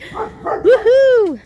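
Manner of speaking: high, drawn-out whoop; gleeful long cheer. Real, not acted. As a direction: celebrate with a high sing-out. Cheering in kick game.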